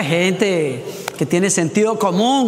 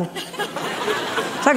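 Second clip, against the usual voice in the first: breathy laugh; 8 semitones higher, 6 dB quieter than usual. Real, not acted. Laughing at his own story.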